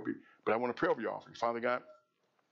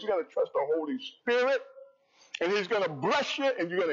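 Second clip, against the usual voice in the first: full-force preaching; 7 semitones higher than usual. Acted. Pastor rousing the congregation with blessings, full-force preaching. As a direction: preach it up to the rafters, lifting each line.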